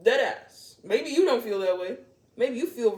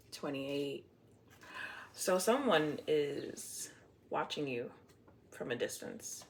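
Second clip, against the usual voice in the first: hushed; 5 semitones lower, 8 dB quieter, slower than usual. Acted. Hushed mysterious reading line.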